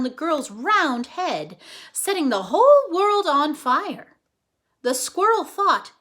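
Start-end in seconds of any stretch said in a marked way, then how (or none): none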